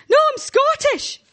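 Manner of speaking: a tiny woman squeaking back in alarm. Retold or imitated. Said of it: "She squeaked".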